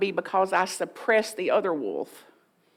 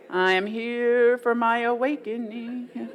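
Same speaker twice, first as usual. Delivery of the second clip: slow, drawn-out intoning; mock-solemn declaration. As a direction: intone it as if not meaning it. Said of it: "if today you actually meant it".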